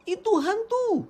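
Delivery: high, startled shout of fear; frightened cry. A narrator's reading. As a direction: cry out as if seeing a ghost. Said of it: "mereka terkejut dan berseru".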